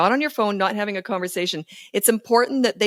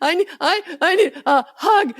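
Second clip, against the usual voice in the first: put-on higher, slow, laughing child plea; whiny child; 10 semitones higher than usual. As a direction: beg for a hug like a toddler. Child voiced after tantrum.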